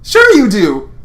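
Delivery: high, loud, pushy cheer; nervous Lance. Acted. Lance pressing the heroine about cake, high and loud.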